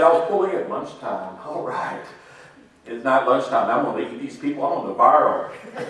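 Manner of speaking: ordinary delivery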